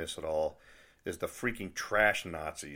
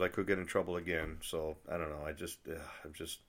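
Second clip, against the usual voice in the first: ordinary talk, nothing marked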